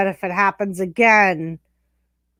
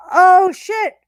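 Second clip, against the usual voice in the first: surprised; 8 semitones higher, 6 dB louder than usual. Real, not acted. Sudden shock watching the match.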